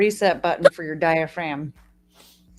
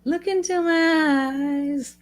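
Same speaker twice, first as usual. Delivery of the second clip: slow spooky hypnotist voice; 6 semitones higher, much slower than usual. Pretend. Mock hypnotist echoing a friend.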